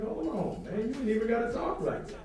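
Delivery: incredulous retort. Retold or imitated. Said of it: "I'm like".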